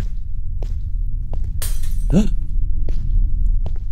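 sharp frightened gasp; scared gasp. Real, not acted. Fleeing Santa in horror game.

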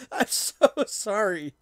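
high, loud, laughing through the words; helpless real laughter breaking into speech; laughing apology. Real, not acted. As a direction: crack up while explaining your own silly mistake. Cracks up at own misreading.

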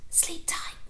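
soft, breathy bedtime whisper; tender whisper. Acted. Mother at the doorway to her sleepy son, whispering softly. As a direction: breathe it gently so he stays asleep. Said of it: "but instead she said".

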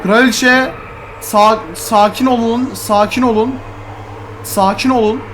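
high loud nervous pleading; calm the queen down in panic; panicked pleading. Real, not acted.

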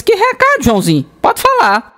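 squeaky high-pitched teacher. Acted.

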